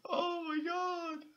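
high, slow, half-crying laugh; nervous laughing. Real, not acted. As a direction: laugh off the fright, shakily. Nervous laughter after scare.

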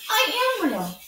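high, loud pleading protest; a toy rabbit close to tears insisting he is real; near tears. A narrator's reading. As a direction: insist desperately, on the edge of crying. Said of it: "he nearly began to cry".

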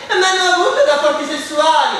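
pry like a nosy group member; nosy Janice. Acted. (Janice curiosa, voce più acuta)

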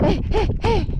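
loud shooing calls. Real, not acted. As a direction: drive the animals off. Shooing animals away.

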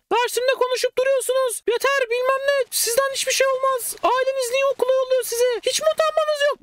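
mock hysterical teacher yelling at students; shrill teacher imitation. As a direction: screech at the class like the hated teacher. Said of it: "Böyle iğrenç ince bir sesi var kadının".

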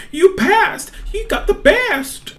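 excited reveal. Acted. Teacher reveals a top result.

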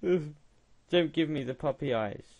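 usual speaking voice

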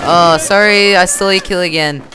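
loud, high, hurried apology; blurted apology. Real, not acted. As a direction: blurt out a quick loud sorry. Blurted apology for stealing kill.

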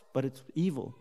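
ordinary talk

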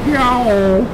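high meowed answer; cat's yowl. Acted. Cat answers the kid at the tiller, yowling. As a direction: answer with a sharp cat cry.

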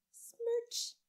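high squeaky kiss smack; a high, playful smooch noise; kiss sound. A narrator's reading. Mother kissing Andrew goodnight, high squeaky smack.

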